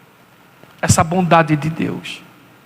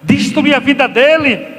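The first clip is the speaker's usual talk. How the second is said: worried father; 6 semitones higher than usual. Retold or imitated. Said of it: "Eu vi do pai aqui e falo assim".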